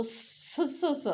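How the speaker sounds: a drawn-out hissing स. Shown as a demonstration. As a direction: hiss the स like a snake. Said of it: "इसको hissing या हशिंग sound कहते हैं". (लंबी सिसकारती स)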